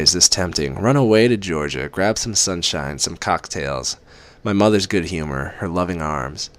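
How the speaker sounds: ordinary delivery, nothing marked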